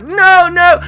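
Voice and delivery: high, loud drawn-out wail; panicked cry. Acted. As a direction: cry out like a man who's been shot. Shot man panics.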